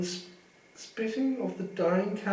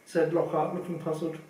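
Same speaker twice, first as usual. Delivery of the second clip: plain reading voice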